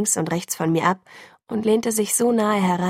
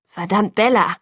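louder, breathless curse; panting; 2 semitones higher, 5 dB louder than usual. A narrator's reading. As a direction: gasp it out, fighting for control. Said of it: "keuchte er".

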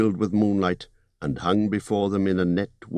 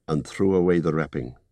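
ordinary delivery, nothing marked

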